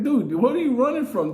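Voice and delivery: high, loud teasing question; mocking question. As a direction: poke fun at the lazy tiger. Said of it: "looked like".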